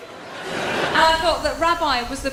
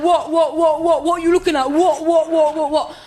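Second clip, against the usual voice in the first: loud, rapid-fire mock challenge; imitating mouthy girls, barking it out; confrontational popular girls; 2 semitones higher, 6 dB louder than usual. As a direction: square up like an aggressive schoolgirl. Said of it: "'cause they'd be like".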